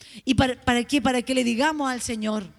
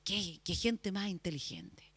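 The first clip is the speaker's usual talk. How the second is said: whispered, slow, full of awe; hushed awed whisper; 5 semitones lower, 11 dB quieter than usual. Pretend. A visitor marvels at clever people, whispering. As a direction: breathe it like an impressed guest.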